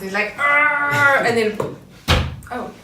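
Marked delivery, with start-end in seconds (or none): 0.4-1.2 loud, raised Wolverine-style "arr"; playful roar imitating an angry Wolverine; mock angry growl. Host showing how angry Wolverine gets, mock angry growl. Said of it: "That's how angry he gets".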